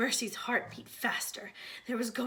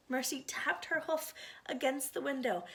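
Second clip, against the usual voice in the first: plain reading voice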